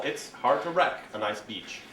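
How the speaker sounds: misheard phrase. Shown as a demonstration. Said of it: "deal with mondegreens and the chaos".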